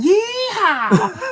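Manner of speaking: very high shouted hillbilly whoop. Retold or imitated. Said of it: "he had that dumb accent".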